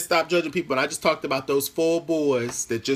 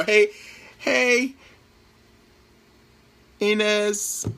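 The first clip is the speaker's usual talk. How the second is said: excited greeting; 8 semitones higher, much slower than usual. Real, not acted. Greeting a viewer by name.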